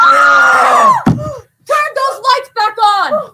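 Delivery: high shriek into a frantic order; panicked scream. Acted. Guests plunged into darkness as a shot fires, panicked scream. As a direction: cry out in high-pitched panic.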